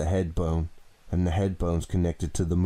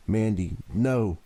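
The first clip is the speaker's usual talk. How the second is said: pleading cry; 4 semitones higher, 5 dB louder, slower than usual. Acted. Tied victim pleads with captor.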